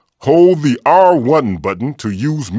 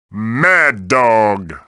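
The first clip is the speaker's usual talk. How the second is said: normal talking voice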